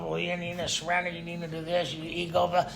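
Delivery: soft, droning mock lecture; lightly imitating a preachy old-timer, murmured; mocking the preachy guy. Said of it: "he's going on about the stuff".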